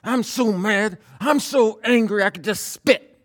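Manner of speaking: high, loud mock rage; venting anger. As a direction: act out blowing off steam, voice way up. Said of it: "you gotta vent it to somebody".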